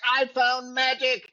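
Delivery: high, slow, starry-eyed mock announcement; hammed-up newcomer gushing about a discovery; mock excited. Newcomer at AA mocked, gushing high and slow. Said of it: "they start running around being like".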